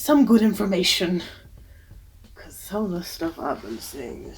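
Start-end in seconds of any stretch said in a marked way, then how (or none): 2.3-4.4 breathy whisper, much softer, under the music; whispered aside. Acted. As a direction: lean in and whisper it like a secret.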